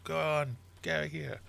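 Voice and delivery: high, rude bird voice; cheeky tern. Retold or imitated. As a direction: brush him off, high and cheeky. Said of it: "they're just like".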